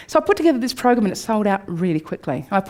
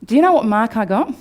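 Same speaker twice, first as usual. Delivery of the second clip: ordinary delivery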